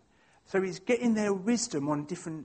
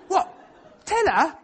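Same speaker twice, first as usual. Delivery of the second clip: surprised; 10 semitones higher, 7 dB louder than usual. Retold or imitated. Retelling his shocked reply.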